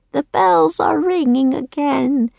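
put-on slow, high creaky old voice; creaky old woman. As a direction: croak it slowly like a toothless old woman. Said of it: "she said in a creaky voice".

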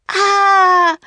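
high, loud drawn-out scream; one sustained "ah" of fright, high and loud. A narrator's reading. Two children facing a sea serpent, screaming. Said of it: "screamed Jack and Annie".

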